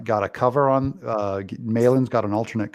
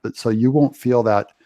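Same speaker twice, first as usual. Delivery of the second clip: ordinary talk, nothing marked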